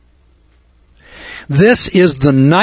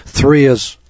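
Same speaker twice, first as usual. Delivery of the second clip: ordinary talk, nothing marked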